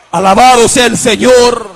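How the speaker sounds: loud, ringing preacher's exclamation; exultant praise. Real, not acted. Preacher praises the Lord after healing story, exultantly loud.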